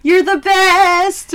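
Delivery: high, loud, slow gushing thanks; gushing kid. Retold or imitated. Child thanking the aunt for a Furby, slow and gushing. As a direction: stretch it out like a delighted kid.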